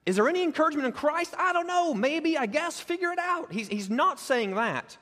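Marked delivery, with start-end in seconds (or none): 0.0-1.3 Christ rising high and fast like a real doubt, any lifted; mock-doubting question; doubtful questioning. Shown as a demonstration. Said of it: "is he questioning this?". 1.4-3.5 high, offhand, careless shrugging; playful mock uncertainty; mock shrug. Shown as a demonstration. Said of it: "is he questioning this?".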